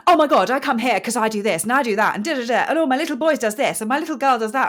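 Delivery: loud, fast, high-pitched gossipy chatter; hammed-up chatterbox rattling on at full volume; gossipy mum. Host mimics a woman gossiping in yoga, mock gushing. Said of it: "just wants to talk and gossip".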